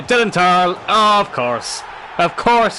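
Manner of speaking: high and loud, caught up in the goal; excited goal reaction. Real, not acted. Gamer celebrating his striker's finishing touch, excited. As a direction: cheer the late winner as it goes in.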